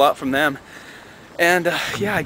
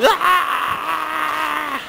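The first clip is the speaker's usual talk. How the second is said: high mock child shriek; kids screaming. Teacher imitating kids running wild in class, high shriek. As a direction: shriek like a wild five-year-old.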